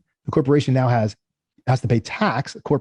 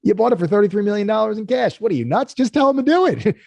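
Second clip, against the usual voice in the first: exasperated; 9 semitones higher than usual. Retold or imitated. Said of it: "I was like".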